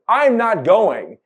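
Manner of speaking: loud, slow, high-pitched mock refusal; defiant child. Eldest son refusing his parents' trip to build a home, defiant. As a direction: dig in like a protesting kid, voice pushed up.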